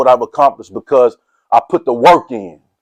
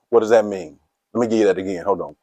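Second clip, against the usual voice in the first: ordinary delivery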